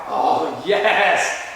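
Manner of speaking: drawn-out, savouring exclamation; retold relish. Younger sibling relishing revenge on brother, gleefully excited. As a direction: act out delighted anticipation of payback. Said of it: "in all of my excitement".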